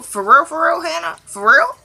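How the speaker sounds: high, incredulous for real; real disbelief. Real, not acted. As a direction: ask it like you can't believe her. Outraged disbelief at Hannah.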